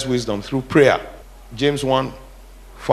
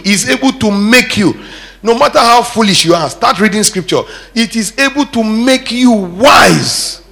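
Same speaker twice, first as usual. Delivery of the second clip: high, driving repetition, building louder; full-force preaching; 7 semitones higher, 6 dB louder, slower than usual. Real, not acted. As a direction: hammer the verse home with rising force. Preacher builds a scripture line.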